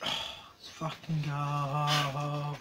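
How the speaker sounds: low, very slow, soft cheer; hushed hype cheer. Real, not acted. Collector cheers over his new package, hushed and drawn out. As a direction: draw the words out softly and low.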